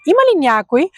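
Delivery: rising question tone on „Има ли някой?“; example question. Shown as a demonstration. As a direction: ask „Има ли някой?“ with a rise at the end like calling through a door. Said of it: "You can say".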